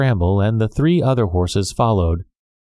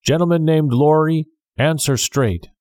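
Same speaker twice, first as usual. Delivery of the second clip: calling out; 7 semitones higher than usual. A narrator's reading. Guard calls out to a passenger.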